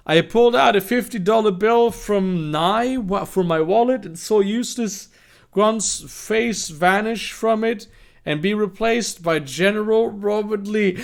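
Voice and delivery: high-pitched, animated read-aloud; story narration. Acted.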